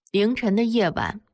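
ordinary delivery, nothing marked

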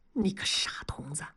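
whispered, soft and low; hushed scolding. A narrator's reading. Master confides in his silly apprentice, hushed scolding. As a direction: scold under your breath. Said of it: "压低声音说".

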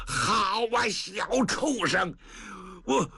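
high, slow furious curse; enraged scolding. Acted. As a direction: spit out the insult. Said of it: "老程哪受得了啊". (怒不可遏地骂)

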